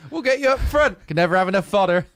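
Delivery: high, loud jovial sergeant; hearty sergeant. Acted. Narrator voicing the sergeant to the recruit, loud. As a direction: boom it out like a cheerful brute.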